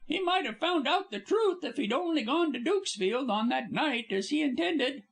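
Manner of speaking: high, chattering star voice; babbling actress. Acted. Actress Polly babbling to the detectives, high. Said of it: "babbled on".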